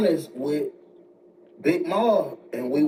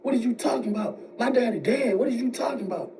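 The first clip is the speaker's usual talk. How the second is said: high-pitched, indignant protest without shouting; imitating an angry son; 9 semitones higher, 3 dB quieter than usual. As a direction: act out outraged disbelief at your mother. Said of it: "Like, he got mad".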